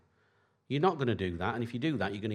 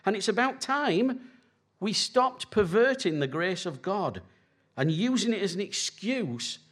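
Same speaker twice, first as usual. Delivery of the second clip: raised, higher preaching voice; 8 semitones higher than usual. Acted. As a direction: drive it home from the pulpit. Preaches on church boundaries.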